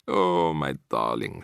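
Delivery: low, slow tender sigh. A narrator's reading. Grandmother hugging the boy, tender low voice. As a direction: hold him close in words. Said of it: "she cried".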